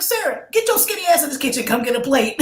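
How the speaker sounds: loud, high bossy grandma call; high, loud summons to the kitchen; grandma yelling. Retold or imitated. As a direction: holler it across the house like a Southern grandma. Grandma calls girl to kitchen.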